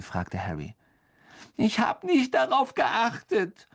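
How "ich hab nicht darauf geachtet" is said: high, slow, indignant ghost whine; flaring up. A narrator's reading. Ghost snaps back at being questioned, high indignant whine. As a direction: flare up in a high, offended wail. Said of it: "sagte Myrte aufbrausend".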